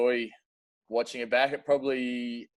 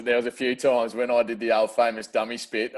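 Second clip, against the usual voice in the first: ordinary talk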